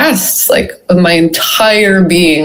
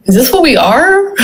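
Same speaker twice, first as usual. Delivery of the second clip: awed wonder; 8 semitones higher than usual. Retold or imitated. Awed question in vision.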